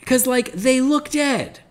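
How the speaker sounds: high, slow insistence that they look dead; insistent emphasis. Real, not acted. Insisting to co-hosts the characters look dead, high and drawn out. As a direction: lean hard on how dead they look. Pointing out bodies looked dead.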